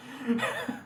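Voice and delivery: short, high chuckle; real high-pitched little laugh; soft burst of amused laughter. Real, not acted. Laughs at cookie mix-up.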